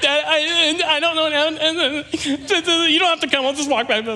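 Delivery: put-on very high, blubbering child's voice; hammed-up sobbing through the words; mock crying. Third grader phones dad about broken bike, mock crying. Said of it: "I'm literally just crying".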